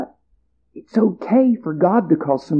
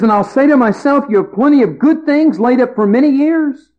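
higher, self-satisfied farmer talking to himself; a higher, pleased-with-himself farmer planning his ease; smug rich farmer; 5 semitones higher than usual. Acted. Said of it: "He says".

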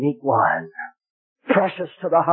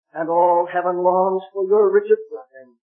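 ordinary delivery

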